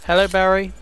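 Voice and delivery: normal talking voice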